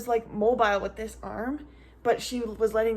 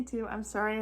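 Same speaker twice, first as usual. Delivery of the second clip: normal talking voice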